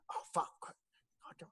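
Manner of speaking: lightly garbled, voiceless cursing; orc with loose jaw. Acted. Orc fumbles his dislodged jaw, muffled cursing. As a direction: curse through an unhinged jaw. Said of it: "unhinges for a second. He goes".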